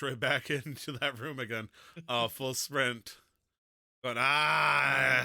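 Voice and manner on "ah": long, loud "ah" war cry; charging yell. Acted. Player sprinting back into the room, charging yell.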